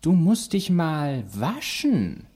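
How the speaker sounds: high, very drawn-out disdain; haughty talking cat. Acted. Cat complains to dog about the smell, haughty. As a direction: stretch it out with swooping feline snootiness.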